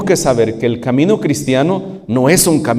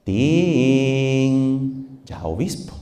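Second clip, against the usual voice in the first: sly woman; 5 dB quieter, much slower than usual. Acted. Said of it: "toda maliciosa".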